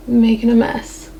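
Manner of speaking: strained, breath held while concentrating; holding breath. Real, not acted. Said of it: "I hold my breath while I'm doing eyeliner".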